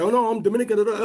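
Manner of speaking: mock a Dominican man protesting he's local; Dominican accent. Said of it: "with the Dominican accent".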